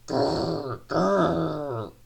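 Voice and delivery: loud rolling engine growl; angry roar. A narrator's reading. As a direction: roar "grr" in lost patience. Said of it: "he roared".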